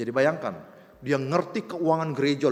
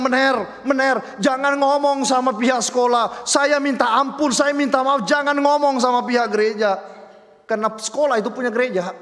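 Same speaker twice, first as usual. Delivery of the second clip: loud, raised-pitch begging in a retold quote; urgent pleading; 8 semitones higher, 7 dB louder than usual. Student begs teacher not to tell the church, urgent pleading. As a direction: plead insistently, acting out the frightened student.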